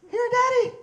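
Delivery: put-on high, loud little kid's voice; little girl voice. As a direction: chirp it like a toddler. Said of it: "She said".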